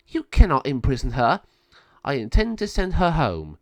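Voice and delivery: indignant, firmly stressed protest; cross. A narrator's reading. Lama Sabio defying Malvado over the imprisoned girl, cross. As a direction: raise the voice in stern defiance. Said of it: "became very cross".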